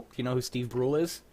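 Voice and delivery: normal talking voice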